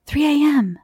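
usual speaking voice

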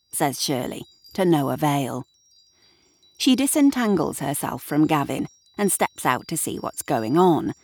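plain reading voice

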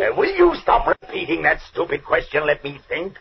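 high, irritated rebuke; fed up and tense, pitched high; exasperated. Acted. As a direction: snap at a panicking partner. Fed up with repeated question.

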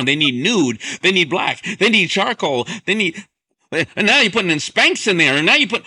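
mounting excitement. Acted. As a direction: build it up, item after item, getting carried away. Piling up hosiery demands.

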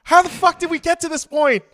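high, loud, exasperated bewilderment; retold disbelief. Chef retells learning of a knife fight, loudly incredulous. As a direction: re-enact total bafflement at full volume.

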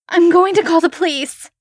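high, loud frightened cry; panicked threat. A narrator's reading. Woman threatens the thing under her bed, shouting. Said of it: "This threat".

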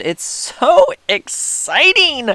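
slow, drawn-out, high-pitched thrill; excited gushing. Real, not acted. Fan reliving the Niners coming out, thrilled. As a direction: gush it, stretching the words out high.

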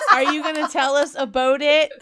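high, amused mock Canadian; mock Canadian accent. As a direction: tease with a Canadian "about" like "a-boat". Teasing Brenda's Canadian accent.

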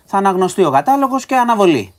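high-pitched exasperated complaint; indignant. Real, not acted. Indignant complaint about chaotic trial.

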